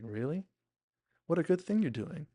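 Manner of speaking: surprised. Retold or imitated. Her reacting to his offer to help, surprised. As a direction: act pleasantly taken aback. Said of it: "she's so surprised".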